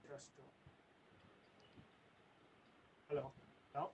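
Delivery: very faint, quiet voice; faint and distant from the mic; barely audible. Real, not acted. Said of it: "No, it's very low".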